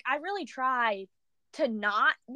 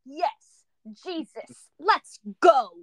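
fired up cheer; 6 dB louder, much slower than usual. Retold or imitated. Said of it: "I was all fired up".

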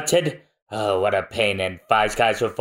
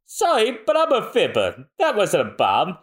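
teasing brag, pitched up; high gloating taunt; 6 semitones higher than usual. Acted. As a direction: gloat like a trickster. Usopp gloats.

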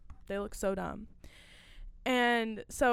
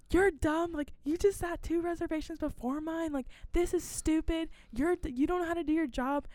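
raised, higher mock rant at the hostess; imitating angry husband; 7 semitones higher than usual. Retelling the husband scolding her at the stand, a higher mock rant. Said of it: "like, yelling at me".

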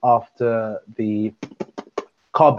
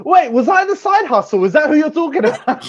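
high-pitched, incredulous outburst; hammed-up jealous disbelief; mock indignant realisation; 14 semitones higher, 3 dB louder than usual. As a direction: leap up in pitch, playfully scandalised. Mock jealous realisation of being side hustle.

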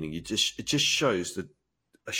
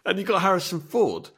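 ordinary talk

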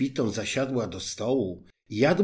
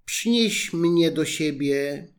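frog demanding; 5 semitones higher, 4 dB louder, slower than usual. A narrator's reading. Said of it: "stanęła tam i woła".

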